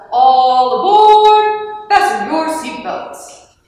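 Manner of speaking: long drawn-out, loud conductor's call; slow, sung-out boarding announcement. A narrator's reading. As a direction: call all passengers aboard, stretching the words. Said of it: "Otto called out to them".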